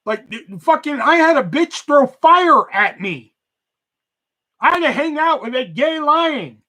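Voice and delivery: high, loud, incredulous ranting; outraged rant. Real, not acted. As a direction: vent the disbelief, building in outrage. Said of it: "That rant h-, was hilarious".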